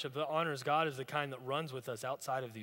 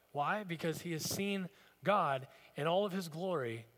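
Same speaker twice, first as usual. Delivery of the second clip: usual speaking voice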